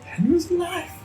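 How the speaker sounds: high, amazed farmer voice; overjoyed farmer. Acted. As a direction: marvel at the bird, voice up high. GM voicing the farmer.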